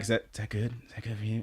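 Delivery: soft intimate question. Retold or imitated. Imitating asking partner during sex.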